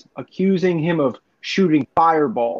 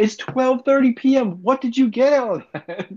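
exasperated rant; 9 semitones higher than usual. Acted. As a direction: demand it in raised-pitch disbelief. Ranting about fireworks set off midday.

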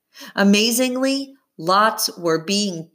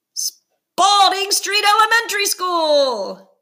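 triumphant, much higher and louder reveal; excited announcement; 13 semitones higher, 4 dB louder than usual. Acted. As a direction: burst out the winner's name. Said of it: "he paused for effect".

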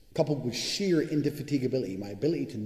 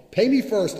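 much higher, louder demand; 10 semitones higher, 10 dB louder, slower than usual. Real, not acted. Setting his terms to clients, loud demand. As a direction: bark the condition. Ranting about getting paid.

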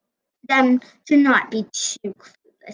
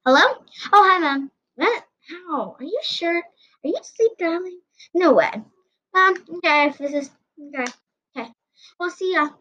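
surprised phone call; 6 semitones higher than usual. Acted. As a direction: react to shocking news from Mom.

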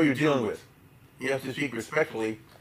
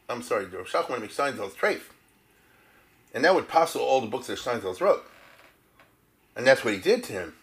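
ordinary talk

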